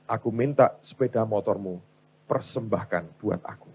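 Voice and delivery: low, hushed divine request; God speaking softly. Acted. God asking the wife for her motorbike, low and quiet.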